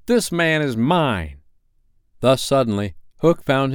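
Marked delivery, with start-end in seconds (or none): 0.1-1.4 slow, high declaration; drawn-out heroic claim; heroic challenge. A narrator's reading. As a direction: claim the opponent, stretching each word. Newcomer claims Hook for himself.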